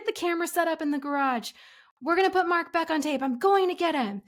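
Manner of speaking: high-pitched urgent yell. Retold or imitated. Yells orders to Devin before driving off, yelling. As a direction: holler orders through the house. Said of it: "I yelled to Devin".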